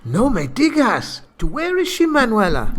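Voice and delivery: mock Spanish surprise. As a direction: gasp it like a soap-opera hero. [name] exclaims in accent.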